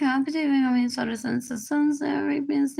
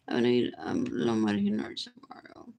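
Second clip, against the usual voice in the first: normal talking voice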